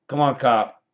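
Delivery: loud, high jeer at the cop; taunting call. Real, not acted. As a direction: goad the cop loudly. Taunting the cop zapping him.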